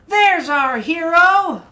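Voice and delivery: high, loud, slow cheer; jubilant shout. Acted. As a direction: hail the hero to the crowd. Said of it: "the boy shouted jubilantly".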